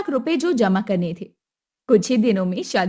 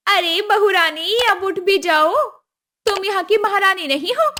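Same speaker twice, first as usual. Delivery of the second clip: higher, scolding call to the bride; mild angry yell; 8 semitones higher, 4 dB louder than usual. Acted. Mother-in-law Kusum yelling at the late bride, mildly angry. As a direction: haul her out of bed with a raised voice. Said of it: "उसने चिल्लाकर कहा".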